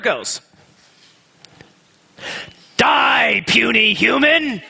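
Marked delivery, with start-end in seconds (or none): 2.8-4.6 high booming dragon shout. Shown as a demonstration. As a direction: roar it like a monster threatening prey. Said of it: "I'm gonna read you my favorite sentence".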